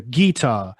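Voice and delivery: "guitar" as GEE-tar, front syllable stressed; Southern-style "guitar" with a long, stressed GEE; first-syllable stress. Shown as a demonstration. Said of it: "stress on the first syllable".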